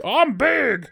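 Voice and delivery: high, puffed-up claim; mock trainer bluff. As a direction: puff yourself up at the tiger. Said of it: "rescues him by going".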